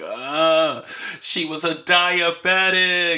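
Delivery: high, loud, drawn-out fake wail; hammed-up melodramatic sob; mock crying. Podcaster imitating the officer crying over his horse, hammed-up wail. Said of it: "the officer's crying".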